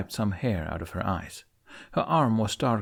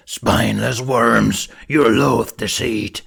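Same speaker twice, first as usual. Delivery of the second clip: slow, much louder monstrous snarl; menacing demon voice; 8 dB louder, slower than usual. A narrator's reading. Demon cursing the fleeing humans, slow booming snarl. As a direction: spit the curse down like a giant beast.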